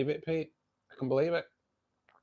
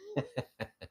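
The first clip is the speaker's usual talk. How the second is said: high giggle; 12 dB quieter than usual. Real, not acted. Laughing at own football joke.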